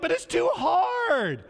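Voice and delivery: high, slow, crying whine; whining tribes. Retold or imitated. Tribes whining to Joshua about their land, high crying whine. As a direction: whine it like a sulking child. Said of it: "they start whining".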